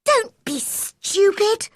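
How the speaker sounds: put-on high, slow, scolding girl; cross cry. A narrator's reading. Mary scolding tearful Colin, crying out crossly. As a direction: snap out of patience, pitched high. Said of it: "cried Mary".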